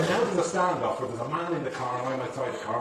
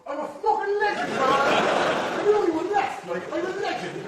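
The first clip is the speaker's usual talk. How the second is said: much higher shouted rant; mock Will Smith shout; 14 semitones higher, 5 dB louder than usual. Hammed-up lone survivor yelling in deserted New York. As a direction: yell it as a hammed-up movie hero. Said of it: "in a deserted New York going".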